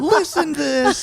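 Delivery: high, drawn-out, laughing holler; mock yelling. Co-host playing up the call down the ladder, mock yelling. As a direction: holler it playfully, slow and way up high.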